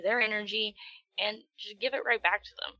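ordinary talk, nothing marked

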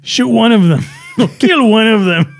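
high-pitched mock villain order; play-acted bandit boss giving orders; bandit chief. Voicing El Guapo's order.

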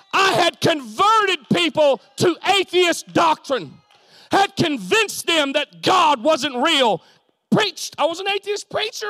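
high, loud, emphatic shouting; full-force preaching. Real, not acted. Preacher testifying that meeting Jesus beat meetings, full-force shout. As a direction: drive each phrase home at a shout.

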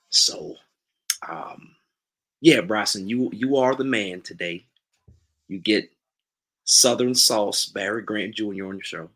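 put-on unhurried Southern accent with pauses. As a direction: announce yourself as a Southern character. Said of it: "in your best Southern accent".

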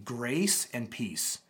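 "Grace and peace" drawn out slowly; "Grace and peace" said slow and soft; slow emphasis on grace. Shown as a demonstration. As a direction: linger on "Grace". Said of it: "You see that opening greeting".

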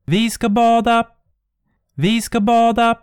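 a phantom's gleeful shriek; high loud ghostly call; shrieking ghosts. Acted.

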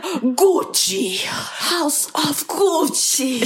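mock Italian accent that lands near Russian; high, very drawn-out, exaggerated Italian-Russian accent. Mocking the film trailer, hammed-up Italian accent. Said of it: "heard [name]'s accent in the trailer".

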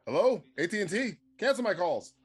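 high, loud put-on businessman; mock phone boss. Acting a busy boss answering the phone, high and loud. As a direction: bark like a busy executive on the phone.

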